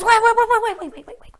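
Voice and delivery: high, loud frantic cries; frantic shout. Acted. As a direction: shout them down in a panic. Princess screams stop.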